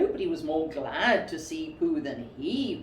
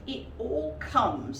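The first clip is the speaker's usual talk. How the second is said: crossly; 6 semitones higher, slower than usual. Acted. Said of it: "said Pooh crossly".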